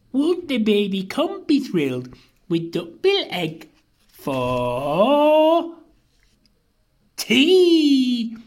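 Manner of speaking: high character voice, rising on the last word; gleeful little dinosaur. A narrator's reading. Little Comp planning baby's tea, high gleeful anticipation. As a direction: build to a high, loud, drawn-out finish. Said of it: "and murmured".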